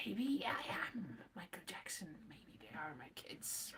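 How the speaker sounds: hushed, breath-only musing; very soft, as if pondering a strange secret; mock-mysterious whisper. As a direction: let it drift down into a whisper.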